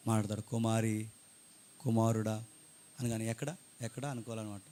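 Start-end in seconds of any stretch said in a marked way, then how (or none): 0.4-2.4 low, hushed imitation of God calling; mock still small voice. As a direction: call softly, as if imitating God. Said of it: "దేవుడు కూడా మెల్లగా మనతో". (మెల్లగా పిలుస్తున్నట్టు అనుకరణ)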